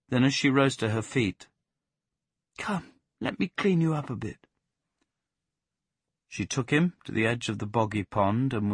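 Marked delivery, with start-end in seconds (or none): 2.6-4.5 put-on higher gentle young woman; lightly voiced Plaxi. Plaxi coaxing the dog to be cleaned. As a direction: coax him up kindly.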